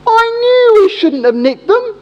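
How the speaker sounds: high, slow, whiny mock lament of a caught thief; guilty bloke. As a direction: wail it like a caught crook blaming his mate. Thief blames his mate.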